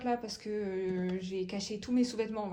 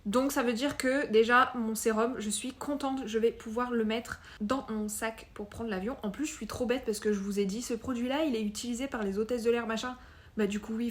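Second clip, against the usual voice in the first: ordinary talk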